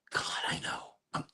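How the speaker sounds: whispered aside to herself; a guilty little whisper. Real, not acted. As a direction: breathe it out, embarrassed. Self-conscious aside.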